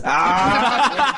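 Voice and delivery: ordinary talk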